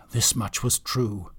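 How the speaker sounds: ordinary delivery, nothing marked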